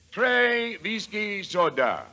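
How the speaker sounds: slow, hammed-up French with a cowboy twang; mock French order. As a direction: order it like a tourist showing off his French. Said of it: "That's, uh, French for highball".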